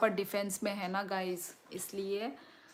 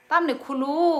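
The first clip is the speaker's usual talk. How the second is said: scolding; 7 semitones higher, 11 dB louder than usual. Real, not acted. Mother scolding her child for opening the packet.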